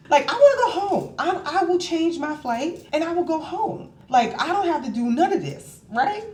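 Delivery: very high, whiny-pitched insistence; acted-out pleading. Retold plea to go home.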